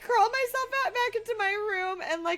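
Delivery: high, giggly telling; laughing. Real, not acted. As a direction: talk through leftover real laughter. Still breaking up while retelling.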